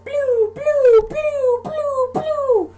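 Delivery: very high, loud squeaky repeated bursts; the blood gushing out, in a very high, loud squeak. Retold or imitated. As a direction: voice the blood spurting out. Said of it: "the blood was like".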